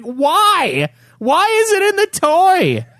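put-on high, exasperated shout at the kid; outraged high yell. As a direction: demand an answer, voice cracking up high. Repeats demand at kid.

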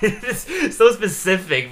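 laughed out, high and slow; laughing. Real, not acted. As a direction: crack up while saying it. Laughing at a quote.

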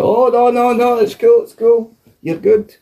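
squeaky high placating voice; nervous backpedaling. Retold or imitated. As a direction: back off fast in a high squeak. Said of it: "And he was like".

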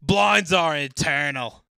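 loud, high, slow Aussie read; slow Australian accent. Acted. Reading a forum answer in a slow Australian accent. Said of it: "in my Down Under accent".

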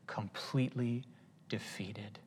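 hushed whisper for effect; breathy dramatic whisper. Real, not acted. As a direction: drop to a whisper on the last word. Dramatic whispered scripture end.

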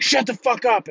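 high, loud strained hiss of an order; exasperated instructor. Retold or imitated. Instructor tries to silence screaming recruit, loud strained order. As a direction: imitate an instructor desperate to silence someone. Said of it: "So he's like".

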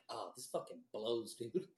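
low, slow, grumbling mock complaint; disgusted gripe. Griping over a bad fry, mock disgusted grumble. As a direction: drag it out like a fed-up customer.

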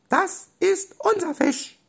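tiny, very high squeak; squeaky Robby voice. Acted. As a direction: squeak like a small stuffed animal. Robby und Delfin protestieren. (hohe, piepsige Stimme)

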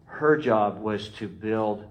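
usual speaking voice

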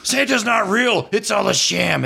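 high, strained ranting Santa; Santa yelling. Acted. Beardless Santa ranting at crying kids, high and strained. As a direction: rant it at the kids like an unhinged Santa. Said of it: "he is yelling at kids".

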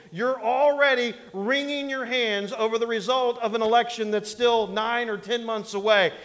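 ordinary talk, nothing marked

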